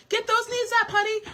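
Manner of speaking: high, loud dance-teacher call; sassy choreographer yelling across the studio; Debbie Allen as choreographer. Retold or imitated. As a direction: bark it at the class like a drill coach. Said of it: "saying to her sister Phylicia Rashad, as a choreographer".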